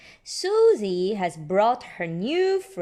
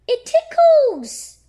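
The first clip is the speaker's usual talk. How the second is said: high, loud, slow squeal; high slow excited cry of a tickled child; exclaiming child; 11 dB louder, slower than usual. Acted. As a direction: squeal it like a giggly piglet. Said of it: "Peppa exclaimed".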